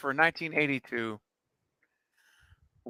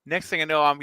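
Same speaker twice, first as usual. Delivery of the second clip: ordinary talk, nothing marked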